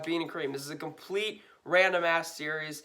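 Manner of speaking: normal talking voice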